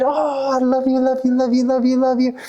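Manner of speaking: put-on high, gushing little-girl coo. Little girl squeezing her new puppy, high gushing coo. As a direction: smother it with love in a child's voice.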